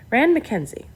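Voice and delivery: put-on man's voice with an Australian accent. A narrator's reading. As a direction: introduce yourself in an Australian accent. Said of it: "the man said with an Australian accent".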